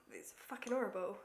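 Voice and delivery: ordinary talk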